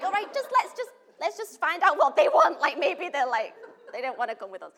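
high, loud, laughing hesitation; nervous laughing reply. Retold or imitated. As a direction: laugh while hedging nervously. Her cautious reply, laughing.

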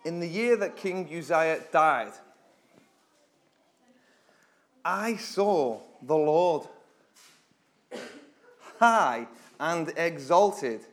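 slow, solemn reading of the verse; scripture reading. Acted. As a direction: read it out like a proclamation. Reads scripture aloud dramatically.

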